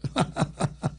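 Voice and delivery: short unvoiced breathy laugh; quick airy chuckle. Real, not acted. Host amused by his critics, soft airy laugh.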